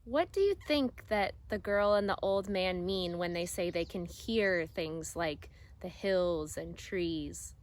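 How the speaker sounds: ordinary delivery, nothing marked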